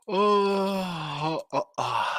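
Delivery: words stretched through a yawn. Real, not acted. Host apologizes to listeners, talking through a yawn. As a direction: let a yawn swallow the sounds. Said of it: "excuse me for yawning".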